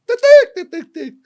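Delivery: high, loud squawked "tic tic"; rapid burst of piercing bird squawks; imitated rooster alarm. Rooster spotting the intruder at the corn, loud high squawks. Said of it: "Aí o galo de lá, quando viu outro".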